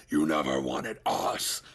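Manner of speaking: a monster growling the blame; demonic accusation. Acted. Entity blaming the father, low and loud.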